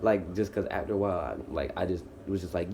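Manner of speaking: ordinary delivery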